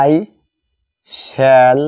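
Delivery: ordinary talk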